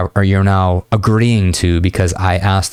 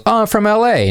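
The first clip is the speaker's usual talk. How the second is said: put-on much higher, casual girlish answer; lightly voiced girl; 13 semitones higher than usual. Coach voices the woman answering his question, light girlish voice. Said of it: "And she goes".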